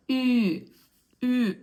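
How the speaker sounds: ü with lips pursed as for a kiss; puckered ü sound. Shown as a demonstration. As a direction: hold ü firm and loud, falling. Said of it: "kiss somebody on the cheek".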